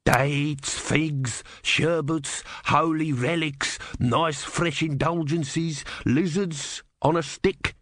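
slow, hopeful vendor patter; hopeful street vendor. A narrator's reading. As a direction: sell it like a hopeful market hawker.

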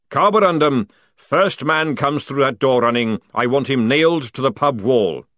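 loud sergeant's command; barked order. Acted. Sergeant ordering a soldier to guard the door, barked. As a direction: call out the order forcefully.

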